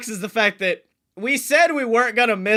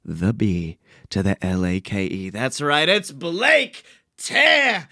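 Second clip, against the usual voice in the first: booming ringmaster hype intro. Pretend. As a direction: shout it to the crowd like a circus host. Wrestling-style hype introduction.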